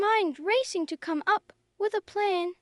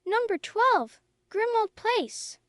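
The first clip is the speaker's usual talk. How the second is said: raised, higher call, slower on the address; 6 semitones higher than usual. Acted. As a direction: call it into the flames. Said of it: "he called out".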